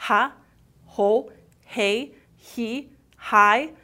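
each syllable opened with a breathy throat h; breathy h drills. Shown as a demonstration. Teacher drilling the h sound with students, breathy. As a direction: breathe out the h on Ha, ho, hey, he, hi. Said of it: "Parece que você tá respirando".

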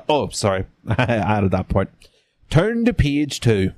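ordinary delivery

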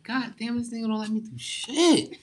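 a high, put-on voice of a whiny teen; mock his younger self griping about his strict dad; teen complaining. Imitating himself as a kid griping about his strict father. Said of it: "as a kid, I was like".

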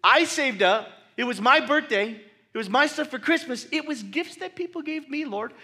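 high, loud, indignant pleading; upset. Retold or imitated. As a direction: protest like a wronged child. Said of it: "like, upset".

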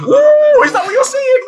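high excited ooh with a laugh; mock excited squeal. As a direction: squeal it like a thrilled flirt. Mimics flirty excited reaction.

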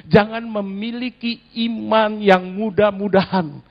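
ordinary delivery

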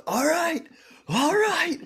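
high, loud self pep talk; man hyping himself to fight a werewolf; psyching up. Retold or imitated. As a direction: gear up for the fight. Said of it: "rolls his shoulders and goes".

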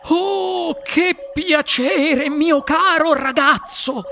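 high, drawn-out cry of delight; overjoyed, gushing exclamation; explosive joy. A narrator's reading. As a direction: burst with joy, stretching the words up high. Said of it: "gioia esplosiva".

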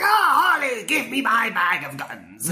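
high, slow, hammy Joker. Retold or imitated. Joker asking Harley for his guns, hammy high voice. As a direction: drawl it like a theatrical Joker.

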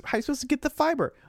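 high, fast, indignant; mock outrage. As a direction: squeal it in outrage. Mock outrage over juice fiber.

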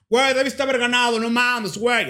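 loud, high indignant gushing; imitated straight fans. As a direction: insist like a worked-up fan. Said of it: "muchos heteros les caí yo".

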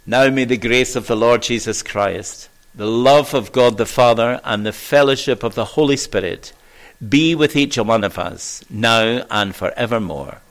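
formal closing blessing; benediction. Acted. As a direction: pronounce the blessing to the whole church.

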